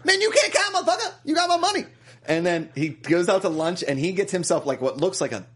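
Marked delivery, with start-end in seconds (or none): 0.0-1.8 high-pitched put-on griping; mock indignation. Accusing a man over money, mock indignant. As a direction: protest in a hammed-up high voice.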